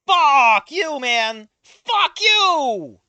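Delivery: loud high angry outburst; angry shout at the author; furious cursing. Real, not acted. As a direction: yell it at the author.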